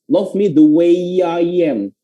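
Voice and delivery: slow "way-yai-yam", words joined by Y glides; slow, smooth connected demo; linked with Y. Shown as a demonstration. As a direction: slowly link "way", "I" and "am" with Y. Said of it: "jadi cara bacanya".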